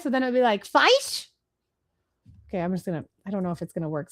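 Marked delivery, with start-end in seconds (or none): 0.7-1.3 "fish" said as "fysh", rising high; joke pronunciation. Shown as a demonstration. Host lands a two-eyes pun, "fish" said as "fysh". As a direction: say "fish" with a long eye vowel, pitched up. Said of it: "you have two eyes".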